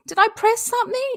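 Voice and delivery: suddenly panicked, voice leaping high; real shock that the stream went silent; startled high squeak. Real, not acted. Panicked that sound is missing.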